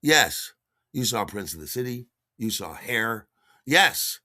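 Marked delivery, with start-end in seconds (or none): none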